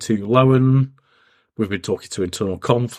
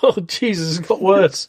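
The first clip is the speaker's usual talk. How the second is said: high exasperated exclamation; 8 semitones higher than usual. Real, not acted. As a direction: groan it in exasperation. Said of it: "laughing at hiccups".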